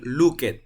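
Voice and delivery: "looked" as two syllables, look-et; wrong extra syllable. Shown as a demonstration. Teacher ruling out the mistake, "looked" as look-et. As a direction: sound out the E in "looked". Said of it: "no va a ser looked".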